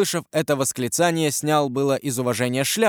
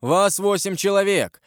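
higher-pitched, challenging statement; defiant; 7 semitones higher than usual. A narrator's reading. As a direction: face them down boldly. Defiant stranger confronts the group.

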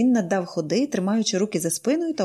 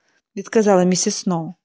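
usual speaking voice

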